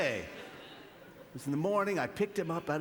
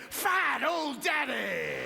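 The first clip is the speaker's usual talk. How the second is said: taunting shout; 11 semitones higher, much slower than usual. Retold or imitated. Son yelling at dad from the school gate, a slow taunting shout. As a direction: hurl it like a cheeky kid. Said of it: "he pointed at me".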